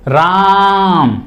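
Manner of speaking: राम drawn out very slowly; prolonged Ram. Shown as a demonstration. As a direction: stretch राम into a long call. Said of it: "ओम में ही ले लीजिए". (राम को बहुत लंबा खींचकर)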